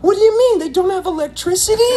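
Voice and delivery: put-on very high, bewildered child's question; confused kid. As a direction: sound like a puzzled little kid. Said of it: "And I'm like".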